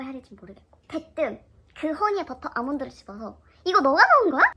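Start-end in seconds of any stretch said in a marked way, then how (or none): 3.6-4.5 loud, high, thrilled question; excited question. Retold or imitated. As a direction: burst out with it. Confronting friend, excited. (신나서 크게 묻는 목소리)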